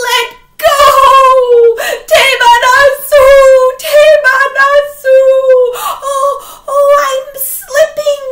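very loud high crying shouts; high shrieking old man slipping; desperate screams. Acted. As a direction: howl in terror. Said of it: "the old man said".

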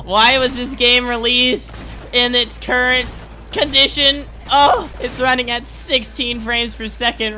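high-pitched exasperated protest; exasperated complaint. Real, not acted. Gamer complaining about tanking frames, exasperated. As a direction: wail the question in frustration.

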